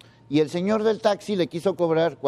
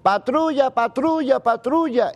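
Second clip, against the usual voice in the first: mock yelling; 8 semitones higher than usual. Anchor imitating someone yelling for a patrol, mock shout. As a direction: call out like a panicked bystander, half-hearted. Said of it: "si yo estoy gritando como loco".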